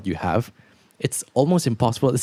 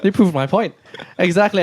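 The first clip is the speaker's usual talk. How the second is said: Andrew Tate manner; 8 semitones higher than usual. Pretend. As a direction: bark it out like a scornful alpha influencer. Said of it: "let's do it in the Andrew Tate manner".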